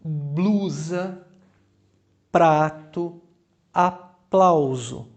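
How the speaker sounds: blusa, prato, aplauso with every consonant cluster sounded out; clear demo pronunciation. Shown as a demonstration. (pronúncia clara de demonstração)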